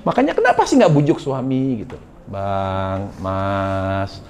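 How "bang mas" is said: a hint of a soft, coaxing wife, softer; lightly voiced tender plea to husband; mock sweet-talking wife. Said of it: "mendayu-dayu lah suaranya".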